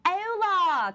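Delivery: high, loud sing-out greeting, mocking the boss; hammed-up cheery Spanish hello from a clueless boss; imitated oblivious boss. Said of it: "she would just come in and be like".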